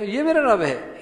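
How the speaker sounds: incredulous question. Shown as a demonstration. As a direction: question it to deny it. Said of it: "لہجے کے لحاظ سے انکار".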